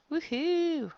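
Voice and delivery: high, loud woohoo; cheering. Real, not acted. Teacher cheering with the class over the answer. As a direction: cheer like you just solved it.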